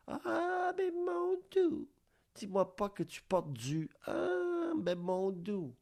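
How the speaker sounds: very high, thin put-on snob voice; hammed-up horrified snob gasping at a label; mock snob. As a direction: shriek it like a scandalized snob. Mocking a snob who buys foreign.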